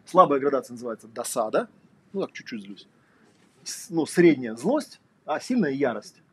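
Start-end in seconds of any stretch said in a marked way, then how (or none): none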